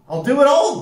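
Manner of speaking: high, loud eager promise; keen young recruit piping up; eager boast. A narrator's reading. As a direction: tout it keenly, pitched right up. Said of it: "Bea touted".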